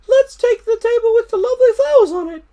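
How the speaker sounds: put-on very high, fast, chirpy child; a little sister picking the pretty table; child's voice. A narrator's reading. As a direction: squeak it like an eager little girl. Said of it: "said another".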